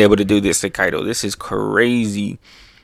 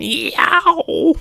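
high, stretched-out yowl; cartoonish high "yow"; imitated blast yowl. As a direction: mimic the sound effect in falsetto. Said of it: "He even makes the sound".

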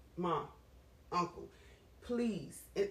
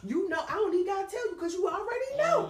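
tearful plea; 9 semitones higher, 5 dB louder, faster than usual. Real, not acted. Pleading to God about fertility.

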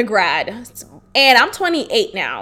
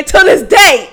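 mock boxer; 4 semitones higher, 7 dB louder than usual. Repeating the meme.

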